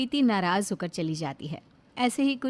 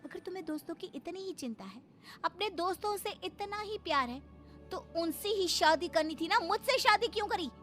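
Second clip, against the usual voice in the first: resentful wife; 8 semitones higher than usual. Acted. Wife to husband who prefers his friends, resentful. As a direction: challenge him as the wounded wife.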